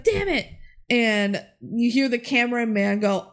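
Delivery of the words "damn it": high, squeaky curse; frustrated Brink. Retold or imitated. Brink pulled down by Val, a high squeaky curse. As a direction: yelp it out in cartoon frustration. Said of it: "Brink's like".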